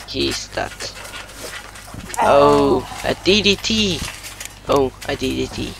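wrestling commentator. Pretend. Kid calls the match live.

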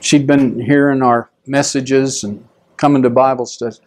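ordinary talk

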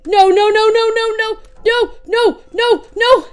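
normal talking voice

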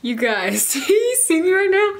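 laughing through tears. Real, not acted. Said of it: "I did not expect to cry".

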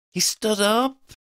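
high, slow gasped words; high-pitched, shocked gasp. A narrator's reading. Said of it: "Freddie gasped".

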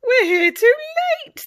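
high, loud groan of dismay; dismayed cry. Acted. Pirates crying out in dismay at rival crew. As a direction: cry out too late. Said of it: "the pirates gasp".

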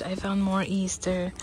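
ordinary talk

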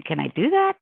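retold disbelief, rising high at the end; incredulous question. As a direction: voice leaps up on a wondering question. Retells own astonished question.

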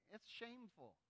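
normal talking voice

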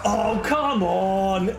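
drawn-out, slow COME ON; exasperated groan. Real, not acted. Player fed up with the game, slow groaned COME ON. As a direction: stretch COME ON into a long groan.

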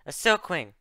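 high, loud cry of alarm; yell. Acted. Luna spots the Silkwing on the sand, yelling. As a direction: call out the warning loudly. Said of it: "Luna yelled".

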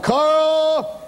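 high, long-held scream; wailing cry, each phrase stretched out high; hollering for help. Retold or imitated. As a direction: re-enact a trapped man's desperate holler. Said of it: "and he hollered".

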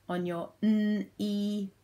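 monotone robot, KNEE split into n and ee. Shown as a demonstration. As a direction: slip into robot talk, word by word. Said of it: "in my robot voice".

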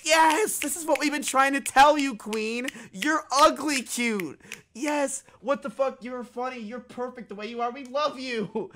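high, sing-song mock gushing; mocking the fawning fans. Mimics her chat gushing at her.

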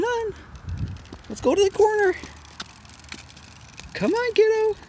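very high, sing-song come on. Real, not acted. Dad coaxes toddler forward.

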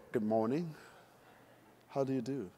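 low, restrained British greeting; understated, low British hello; reserved British greeting. Acted. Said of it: "The British are very what?".

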